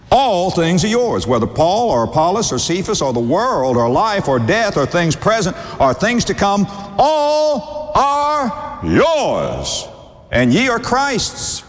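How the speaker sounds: high, building pulpit reading; fervent scripture preaching. Real, not acted. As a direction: build to a very slow, high, drawn-out climax. Preaches scripture at full force.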